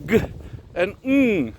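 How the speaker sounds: g as a short stop, ng as a held hum; isolated rune sounds. Shown as a demonstration. Teacher demonstrating the K rune's values, bare sounds. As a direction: say g and ng as bare sounds, not letter names. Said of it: "so that sounds".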